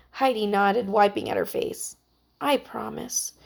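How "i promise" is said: soft, much quieter promise; whispered promise. A narrator's reading. Heidi, in tears, promises her friend: soft whisper. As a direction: barely breathe the promise. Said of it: "she whispered".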